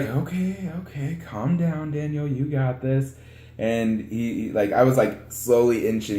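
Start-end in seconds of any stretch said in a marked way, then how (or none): 0.0-3.1 lightly voiced reassuring friend, a bit higher and softer; friend calming him. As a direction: coax him gently, slowly. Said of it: "he's like".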